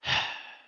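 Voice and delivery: weary unvoiced sigh; heavy sigh of annoyance. Real, not acted. Frustrated after file saved unexpectedly.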